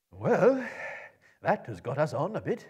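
put-on loud, high old wizard; a booming old wizard pleased with progress; Gandalf's voice. A narrator's reading. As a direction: wizard calls out to the company. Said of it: "said Gandalf".

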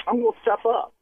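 ordinary talk, nothing marked

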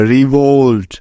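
রিভোল্ট with a gliding "oh-oo" on the second syllable; teacher modelling the "olt" sound; clear model pronunciation. Shown as a demonstration. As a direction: say রিভোল্ট as "ri-vowlt". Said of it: "যেমন".

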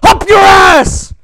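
loud high enraged yelling; furious shout. Real, not acted. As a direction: scream the threat at full force. Yelling at the game monster.

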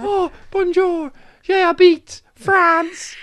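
very high, slow mock French; bad French accent. Shown as a demonstration. Doing French on request, high slow hammed-up French accent. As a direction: stretch out bonjour like a cartoon Frenchman.

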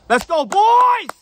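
huge, high, drawn-out hype yell; rallying shout. Real, not acted. Vlogger rallying his fans at sign-off, full-out shout. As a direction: scream it high and long to fire up the fans.

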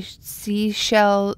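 ordinary delivery

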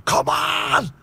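high, drawn-out urgent cry; retold shout. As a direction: shout to bring him round. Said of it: "I'm shouting".

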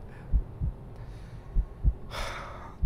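long unvoiced exhale; shaken sigh. Real, not acted. Gamer recovering from a fright, long sigh. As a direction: let out a long breath after a scare.

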